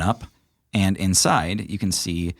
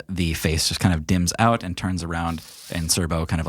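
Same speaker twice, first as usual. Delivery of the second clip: ordinary talk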